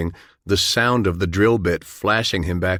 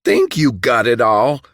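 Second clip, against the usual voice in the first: louder, higher outraged question; indignant cry; 4 semitones higher, 5 dB louder than usual. A narrator's reading. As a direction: throw his words back in disbelief. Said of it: "John cried".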